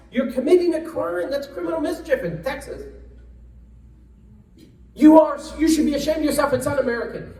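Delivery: high, loud, indignant scolding; scolding rioter. Retold or imitated. Congressman scolds the young rioter through the glass, indignant and loud. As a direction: dress him down like a stern congressman. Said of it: "I says".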